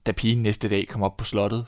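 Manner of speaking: usual speaking voice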